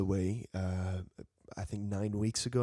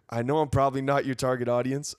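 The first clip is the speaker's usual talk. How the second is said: ordinary delivery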